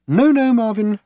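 high, sing-song reassurance; lilted. A narrator's reading. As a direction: soothe him in a lilting voice. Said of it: "lilted Trillian".

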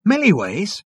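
high, rising echo; surprised. A narrator's reading. Ford echoing Zaphod's announcement, surprised.

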